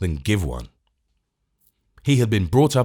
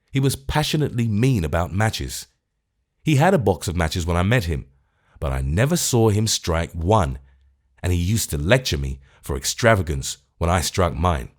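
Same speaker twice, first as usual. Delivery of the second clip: ordinary delivery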